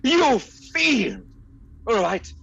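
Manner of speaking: high, loud hammed-up gasp of horror; mock outrage. Captive prince cries out at the villain, hammed-up outrage. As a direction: play the melodramatic hero. [name] reacts to villain's plan.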